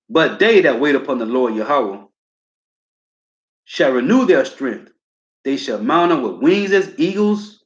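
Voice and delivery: deliberate preaching of verse; scripture reading. Acted. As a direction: read it with weight and pauses. Preacher reads scripture aloud.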